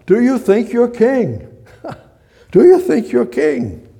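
high, loud incredulous question; mocking Pilate. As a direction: put it as an amused, scoffing Pilate. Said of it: "So Pilate says".

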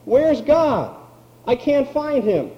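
high, anxious, searching pleas; panicked cry. Pretend. Troubled believer crying out in a crisis, high and anxious. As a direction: sound like someone lost who can't find God. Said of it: "you don't have to say".

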